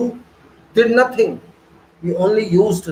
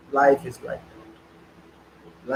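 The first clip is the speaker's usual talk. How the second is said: very slow, lower, quieter, drawn out; mock resigned sigh; 7 semitones lower, 5 dB quieter, much slower than usual. Mocking the excuse before correcting it, slow and resigned. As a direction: sound like someone giving up on life. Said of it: "Very simple excuse".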